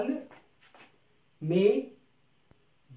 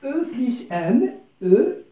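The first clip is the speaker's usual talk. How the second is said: "E plus M" spelled slowly, higher and louder; emphatic spelling; 5 semitones higher, 8 dB louder, much slower than usual. Shown as a demonstration. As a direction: drill "E plus M" loud and slow. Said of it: "وكذلك".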